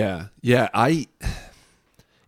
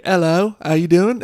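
higher, louder mock South African greeting; South African accent; 7 semitones higher, 7 dB louder than usual. Teasing South African buddy Carlo, loud mock South African hello. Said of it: "he has a South African accent".

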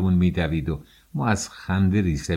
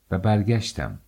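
ordinary delivery, nothing marked

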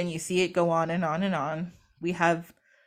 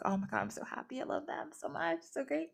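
hushed, a little higher, doting; soft doting joy; 5 semitones higher, 13 dB quieter than usual. Retold or imitated. Atem watching his grandkids, hushed doting joy. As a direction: coo over the grandkids softly. Proud grandfather god in story.

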